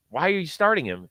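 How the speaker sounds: ordinary talk, nothing marked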